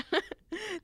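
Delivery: a short high giggle. Real, not acted. Giggling to her friend about the rude word. Laughs at own joke.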